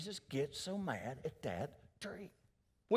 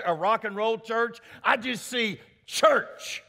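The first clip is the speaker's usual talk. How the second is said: loud, raised preacher's voice; forceful preaching; 6 semitones higher, 6 dB louder than usual. Real, not acted. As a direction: drive each phrase home like a preacher.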